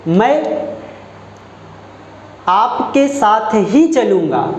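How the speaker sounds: मैं set apart, then slow; emphatic example. Shown as a demonstration. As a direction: pause after मैं, deliberate. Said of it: "किसी शब्द पर बल देना". (मैं पर बल देकर धीरे बोलना)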